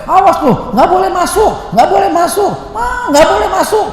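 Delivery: high, loud shrieking scold; scolding mother shout. Retold or imitated. Mother scolding her muddy child at the door, shrill shout. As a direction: screech at the kid to stay out.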